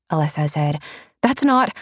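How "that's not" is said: high, urgent, cut off sharply; alarmed protest. Acted. Alessa protesting the accusation, high and alarmed, cut off. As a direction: stop him before he goes on.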